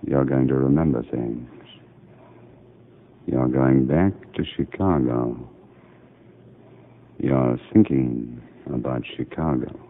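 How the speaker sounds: doctor guiding a drugged patient, softly hypnotic; soothing hypnotic voice. Acted. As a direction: lull her gently toward memory. Doctor hypnotizes patient under narcosis.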